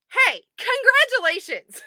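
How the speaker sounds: drawn-out, high, loud cheer; excited congratulations. Real, not acted. Cheering the group's good news, excited. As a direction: congratulate them with real joy.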